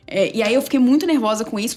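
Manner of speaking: normal talking voice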